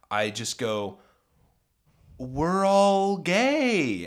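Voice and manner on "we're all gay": very slow, high, loud announcement; drawn-out mock confession; big reveal. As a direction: stretch each word right out. Deadpan lie to deter her.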